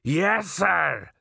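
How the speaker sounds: eager high-pitched troll salute; enthusiastic. A narrator's reading. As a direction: answer the captain with relish, as a troll. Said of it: "said Detritus with considerable enthusiasm".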